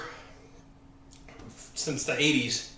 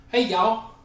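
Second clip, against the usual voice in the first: bright, higher Southern "Hey, y'all"; Southern greeting; 6 semitones higher, 3 dB louder than usual. Retold or imitated. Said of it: "if he were to say something about Southern".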